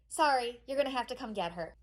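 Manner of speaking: soft, subdued sorry; apologetic refusal. Acted. School to mom about collecting daughter, apologetic. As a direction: break it gently and quietly.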